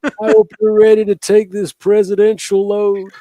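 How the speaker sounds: high, slow, laughing Trump voice; hammed-up Trump impression. Doing Trump sex talk impression.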